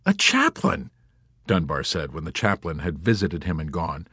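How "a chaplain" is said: very high, marvelling voice; amazed exclamation. Acted. Dunbar marvels after the visit.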